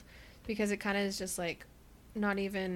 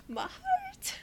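squeaky swoon, much higher. Retold or imitated. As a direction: melt with a high squeal. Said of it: "And I was like".

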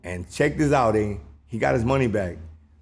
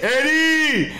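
high, loud shout of the name; angry yell; 10 semitones higher, 3 dB louder than usual. Retold or imitated. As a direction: call him out furiously. Brother yelling his name.

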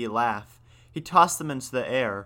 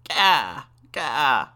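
put-on raspy baby-raven wail, "gah"; two loud, falling caws in a rasping voice; 2 semitones higher, 5 dB louder than usual. A narrator's reading. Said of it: "he wailed in Raven's rasping voice".

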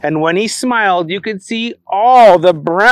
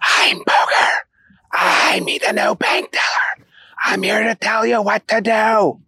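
whispered; 3 semitones higher than usual. Acted. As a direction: hush it like a library. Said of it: "So then he whispered".

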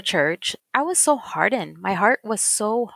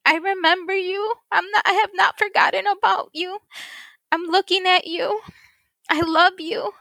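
tearful, emotional; 9 semitones higher than usual. Real, not acted. Voicing God's words, overcome.

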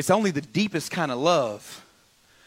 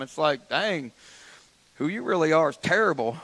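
usual speaking voice

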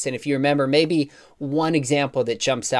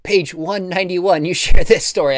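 high, bright mock reader voice, lightly acted; eager reader; 7 semitones higher, 9 dB louder than usual. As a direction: play a keen reader quoting a page at the author. Readers asking author about book page.

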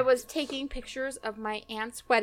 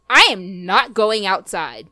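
slow, loud, emphatic refusal; mock defiant refusal; 3 semitones lower, 6 dB louder, slower than usual. Niece retells a wedding quarrel, defiant refusal. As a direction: dig in, each word loud and slow.

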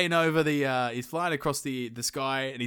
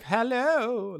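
high, singing hello; gaily; 10 semitones higher than usual. Acted. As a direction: trill a merry greeting. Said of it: "rather gaily and be like".

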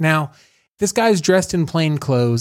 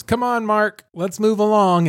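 higher, coaxing voice herding a patient; coaxing nurse; 8 semitones higher than usual. Retold or imitated. Nurse steering the patient away, coaxing and higher. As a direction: coax him along gently but high. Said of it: "And the nurse goes".

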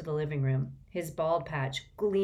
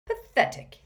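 high, louder teasing scorn; scornful; 9 semitones higher, 5 dB louder than usual. A narrator's reading. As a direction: scold your brother for a bad joke. Brother mocking George's bad joke.

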